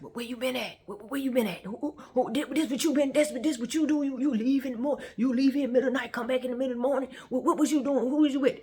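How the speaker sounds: high, loud, stammering interrogation; jealous boyfriend. Retold or imitated. Older man grilling her on coming back late, jealous and loud. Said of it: "everything was jealous boyfriend".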